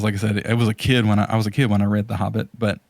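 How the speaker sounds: ordinary talk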